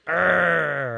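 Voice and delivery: loud, drawn-out "arr" of rage; mock angry growl. Pastor mimicking cyclists angry on Facebook, loud mock growl. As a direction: growl loudly in mock outrage. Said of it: "they might get angry enough".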